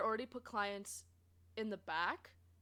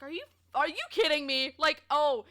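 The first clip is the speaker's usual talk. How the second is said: louder, higher retold disbelief; exasperated; 4 semitones higher, 9 dB louder than usual. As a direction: act out your indignant reaction. Retelling exasperation at locked-out clients.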